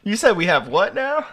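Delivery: high, loud baffled huh; mock confused North Korean. Mocking the North Koreans, feigned baffled confusion. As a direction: play dumb, wide-eyed confusion. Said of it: "They're like".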